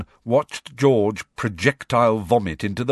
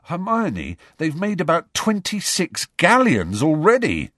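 exasperated; 9 semitones higher, 3 dB louder than usual. A narrator's reading. Ron exasperated at Hermione.

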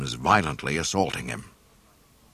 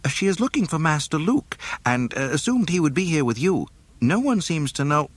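high fussy droid voice; prim robot, slightly flustered; C-3PO; 11 semitones higher than usual. A narrator's reading. C-3PO answers Han.

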